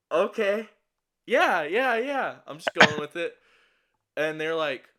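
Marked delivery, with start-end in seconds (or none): 0.1-2.4 high nervous yeah yeah yeah; nervous placating agreement. Retold or imitated. Nervously agreeing with the roommate, high and placating. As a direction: humor the roommate, pitched high and tight.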